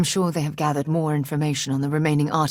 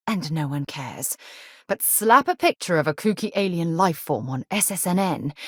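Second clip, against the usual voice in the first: ordinary delivery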